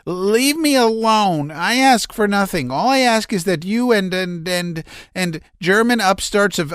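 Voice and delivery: high, strained, nervous voice; a man near hysterics pouring it out; agitated Laevsky. A narrator's reading. Laevsky's stammering threat.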